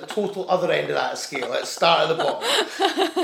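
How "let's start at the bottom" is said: high and slow, laughing as you ask; laughing, asking for the cheapest option; laughing request. Retold or imitated. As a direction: plead for the cheap end, giggling.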